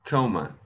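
"coma" with a longer English first vowel; English pronunciation. Shown as a demonstration. Teacher shows pupils how comma is said, English pronunciation. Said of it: "la pronunciación es un poquito más larga".

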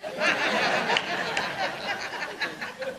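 soft high laugh. Real, not acted. Preacher laughing at his joke, soft and high. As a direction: laugh at the punchline.

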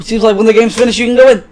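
high, loud delivery; excited announcement. Acted. Player reading out the game's message, high loud excitement. First take, repeated slowly after.

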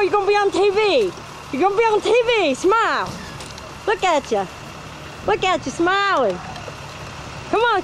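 high, sing-song coaxing. Real, not acted. Filming Cody and urging her to smile, high coaxing baby talk.

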